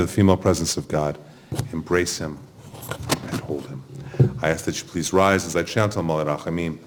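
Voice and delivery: usual speaking voice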